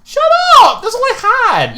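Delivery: high-pitched loud protest; loud, high indignant outburst; indignant shout. Real, not acted. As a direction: yell back at a teasing friend. Yelling back at co-host's gameplay teasing.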